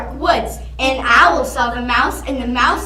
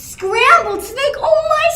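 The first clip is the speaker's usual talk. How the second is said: shocked snake; 12 semitones higher, 4 dB louder than usual. Acted. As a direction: squeal in horror at the word. Snake hears scary menu.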